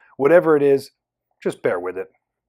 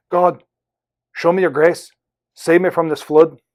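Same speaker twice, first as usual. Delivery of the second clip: put-on louder Irish-accented prayer; 4 semitones higher, 6 dB louder than usual. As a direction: call up to God in a rough Irish accent. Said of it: "in an Irish voice".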